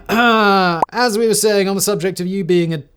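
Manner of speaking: mock-solemn proclamation cut off mid-insult; pompous declaration. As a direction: declare it like an offended assembly. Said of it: "and declared".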